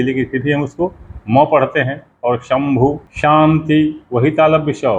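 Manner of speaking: ordinary talk, nothing marked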